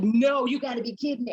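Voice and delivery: excited disbelief. Retold or imitated. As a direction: retell your own stunned joy. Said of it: "I'm like".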